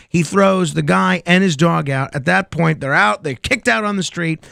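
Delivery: ordinary delivery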